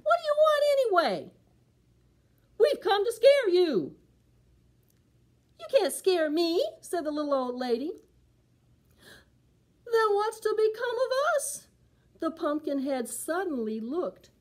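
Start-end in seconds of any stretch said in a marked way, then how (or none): 0.0-4.0 high, fast, loud creature voice; puzzled monsters demanding; haunted clothes talking back; spooky visitors. Acted. The creatures answer her. 5.6-6.6 a hint of a spunky granny; firm, cheeky reply; plucky old woman refusing to be scared; lightly voiced old lady. 9.9-11.7 high, fretful creature voice; spooky clothes suddenly lost; worried creatures. Acted.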